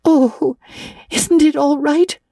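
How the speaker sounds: put-on high, anxious girl's question; anguished. A narrator's reading. Anne fears her cake went wrong, anguished. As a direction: ask in dawning dread, pitched high. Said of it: "with a look of anguish".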